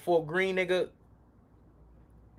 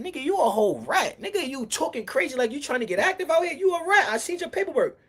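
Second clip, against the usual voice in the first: pitched-up, heated accusation; heated callout; 8 semitones higher than usual. Retold or imitated. To Cobb Butter in the street, a pitched-up heated callout. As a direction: re-enact calling him a rat. Retold calling out a rat.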